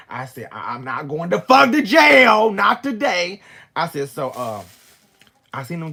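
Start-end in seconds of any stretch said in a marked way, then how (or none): 0.5-3.4 slow, drawn-out loud holler; emphatic refusal. Retold or imitated. Woman refusing to be caught at a murder scene, emphatic holler. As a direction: swell into a high, booming refusal.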